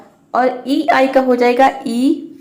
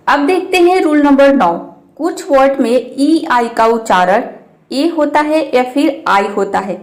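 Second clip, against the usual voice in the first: ordinary talk, nothing marked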